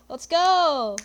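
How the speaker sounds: loud, pumped-up rallying cry; hyped shout. Real, not acted. Streamer psyching himself up for the trial, shouting. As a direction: fire yourself up before court.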